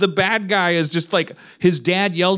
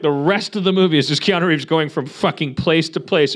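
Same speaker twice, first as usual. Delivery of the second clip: ordinary talk, nothing marked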